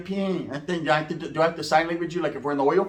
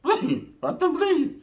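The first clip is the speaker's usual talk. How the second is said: very high, drawn-out begging; mock pleading; 10 semitones higher, slower than usual. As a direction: plead in a squeaky, hammed-up voice. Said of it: "I'm asking kindly".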